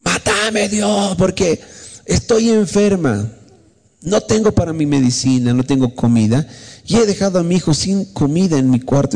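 high pleading cry that gradually comes down; retold desperate shouting. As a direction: act out a mother crying out to God. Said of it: "Y le empecé a gritar a Dios".

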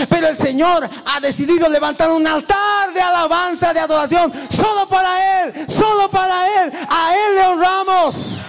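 rising to a high preaching shout; fervent preacher shouting at the devil; rebuking the devil. Real, not acted. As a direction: cry it out in full fervor.

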